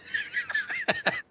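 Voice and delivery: sudden shrill giggle; high squeaky laugh. Real, not acted. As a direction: burst out in a squeal. Laughing at his own joke.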